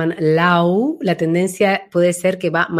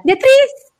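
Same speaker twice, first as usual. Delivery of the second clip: much higher and louder, piercing; shrill high name; 5 dB louder than usual. Shown as a demonstration. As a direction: shriek the name bright and sharp. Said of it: "que no sea".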